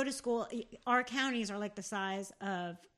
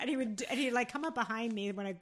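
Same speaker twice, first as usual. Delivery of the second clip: usual speaking voice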